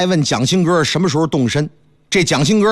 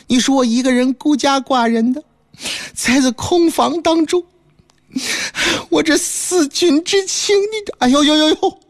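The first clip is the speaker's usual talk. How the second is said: higher, pleading wife breaking into wails; wife crying; 6 semitones higher than usual. Acted. Wife begs husband not to leave, tearful wail. Wife protests then weeps.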